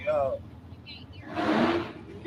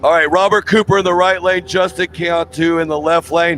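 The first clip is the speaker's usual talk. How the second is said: brisk, slightly fast lane call; quick track announcer read; race announcer; 8 dB louder than usual. Acted. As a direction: announce the racers to the crowd. Announcer calls the lanes.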